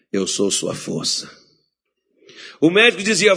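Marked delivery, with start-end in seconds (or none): 0.1-1.4 lower, slow and soft divine voice; voice of God. Retold or imitated. God answering the pastor's fear, calm and low. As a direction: speak it calm and steady like God. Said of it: "Deus dizia".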